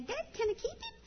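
put-on high begging girl voice; lightly voiced princess asking; Pharaoh's daughter begs her father, high; pleading daughter. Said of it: "asked her daddy".

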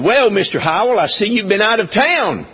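high-pitched imitation of a Bronx accent; imitating a New York customs agent's accent; Northern accent. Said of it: "northern accent".

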